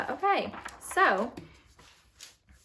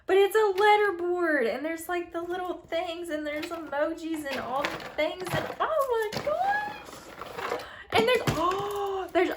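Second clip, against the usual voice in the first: excited gushing; 9 semitones higher than usual. Real, not acted. Unboxing a letterboard for viewers, squealing with real excitement. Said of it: "this is how I act when I open things that I really like".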